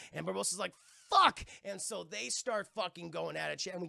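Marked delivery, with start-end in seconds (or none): 1.1-1.5 loud, high burst of cursing; one punched, exclaimed swear. Retold or imitated. Playing Barbosa as Jack proves immortal, mock-dismayed shout.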